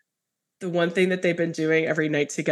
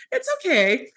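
much higher, gentle comfort; reassuring; 10 semitones higher than usual. Retold or imitated. Telling father-in-law he is safe, high and tender. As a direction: soothe him like a child. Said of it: "I was like".

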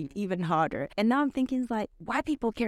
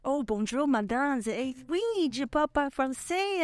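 higher-pitched put-on French accent; faked French accent; 7 semitones higher than usual. Shown as a demonstration. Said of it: "I am really good at faking accent".

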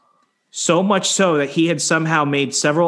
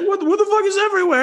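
high-pitched, rapid mock outrage; outraged; much faster than usual. Rabbit's owner walking in on the mess, outraged. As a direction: imitate a shocked friend squawking. Owner's shocked outburst, retold.

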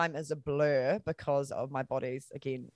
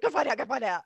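urgent; 9 semitones higher, faster than usual. Retold or imitated. Sister urging her on.

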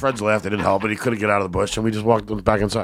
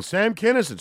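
mock heckler yell; 11 semitones higher than usual. Heckler yelling fat comedians.

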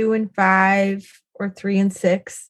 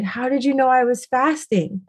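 higher-pitched, jaw-dropped disbelief; astonished question; 5 semitones higher than usual. Retold or imitated. Mom asks her daughter how she knew about the fasting, astonished. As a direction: retell your own stunned question, pitch lifted. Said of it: "my jaw just dropped".

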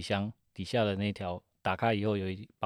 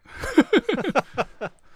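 high burst of laughter; 10 dB louder than usual. Real, not acted. Laughs at joke about money.

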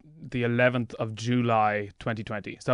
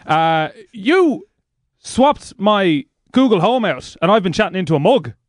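lightly raised comic character voice; Jim character voice; 6 semitones higher, 3 dB louder than usual. Acted. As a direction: play the paranoid Jim, a bit higher. Jim character enters studio.

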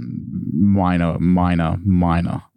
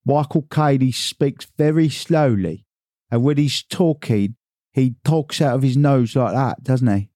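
Michael Caine; 5 semitones higher than usual. Shown as a demonstration. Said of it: "if you want to talk like, uh, Michael Caine".

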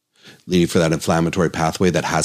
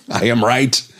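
laughing; 6 semitones higher than usual. Real, not acted.

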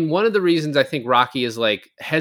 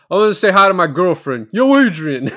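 put-on loud, higher Rocky-style call to his girlfriend; hammed-up Stallone shout, louder and higher; 5 semitones higher, 6 dB louder than usual. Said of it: "He's like".

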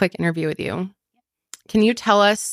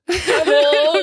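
laughing; 8 semitones higher, 5 dB louder than usual. Real, not acted. Mocks guest's line laughing.

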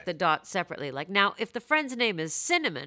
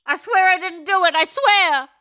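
high, loud pretend pleading; mock protest; 11 semitones higher, 11 dB louder than usual. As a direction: plead innocence in comic panic. Acting the accused letter writer.

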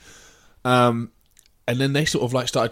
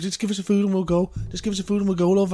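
pitched up, insistent repeat; pleading woman; 7 semitones higher, faster than usual. Retold or imitated. Girl demanding her food at the counter, insistent pleading. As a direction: keep begging for the food. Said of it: "she goes".

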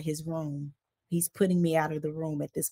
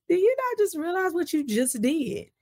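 much higher disbelieving question; incredulous; 11 semitones higher than usual. Retold or imitated. She confronts her husband, high incredulous voice. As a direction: ask it in stunned disbelief. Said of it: "And I'm just like".

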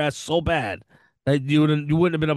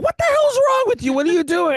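high, loud startled yell; shocked outburst; 5 dB louder than usual. Retold or imitated. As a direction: blurt it out in shock. Retold shock at wife sniffing.

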